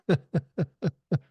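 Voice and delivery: light amused chuckle; can't help laughing, kindly; kind gentle laugh. Real, not acted. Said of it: "I'm laughing because".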